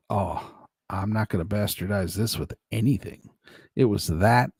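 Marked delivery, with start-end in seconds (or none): 0.1-3.3 hushed awe. Retold or imitated. Retold reverent reaction to tasting.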